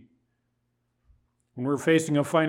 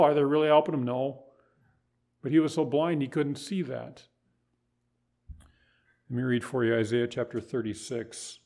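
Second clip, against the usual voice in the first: ordinary delivery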